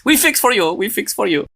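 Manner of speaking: high-pitched imitation Swiss German accent; Swiss German doctor accent. Doctor promising to fix the patient's foot, mock Swiss German accent. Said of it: "I don't know what accent this is".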